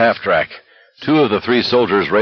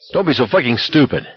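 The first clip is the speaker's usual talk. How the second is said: mild angry yell, pitched up; furious yell; 5 semitones higher than usual. A narrator's reading. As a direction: snap back in raised pitch. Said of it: "Garrity yelled at him furiously".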